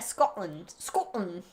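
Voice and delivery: clipped "Scotland" in a rough Scottish accent; Scottish accent attempt. Shown as a demonstration. Podcaster showing her limited accent skills, attempted Scottish. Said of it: "I'm not good with any accent".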